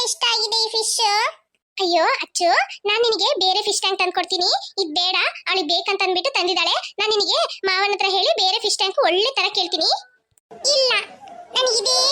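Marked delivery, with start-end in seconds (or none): none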